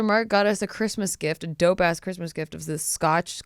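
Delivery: ordinary delivery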